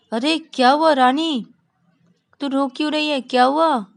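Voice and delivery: high, loud worried questioning; alarmed concern. Acted. Ravi and Shreya ask crying friend what happened, alarmed concern. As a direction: burst out asking, pitched well up.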